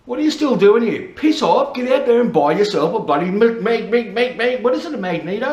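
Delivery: high, loud joking rant; mock scolding. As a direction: pretend to yell at lingering viewers, tripping over words. Mock scolding viewers still watching.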